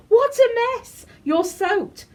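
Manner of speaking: high, loud laughing tease; mock the soaked fairy playfully; high amused bunny voice; amused teasing. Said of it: "he laughed".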